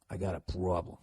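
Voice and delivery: low, soft Boston accent. Shown as a demonstration. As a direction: say "problem" with Boston vowels. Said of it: "the Boston accent kinda came back".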